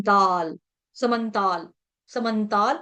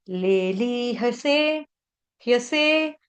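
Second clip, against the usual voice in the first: very slow, split लेली ह से into parts; syllable by syllable; much slower than usual. Shown as a demonstration. Teacher to class, sounding out लेली ह से slowly. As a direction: spell it out slowly for learners. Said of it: "थोड़ा सा तोड़ तोड़ के दिखा देती हूँ".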